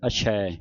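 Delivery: ਅਛੈ with a wide final ai; open ai ending. Shown as a demonstration. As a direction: finish ਅਛੈ on an open vowel. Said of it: "ਛੱਛੇ ਦਲਾਵਾਂ ਲਾ ਕੇ ਉਚਾਰਨ".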